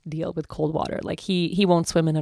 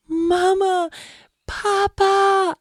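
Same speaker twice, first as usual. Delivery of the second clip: put-on loud, much higher little-girl cry; overjoyed toddler call; 5 dB louder, much slower than usual. Mother voicing her daughter reaching for both parents, high and loud.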